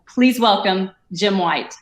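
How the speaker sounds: ordinary delivery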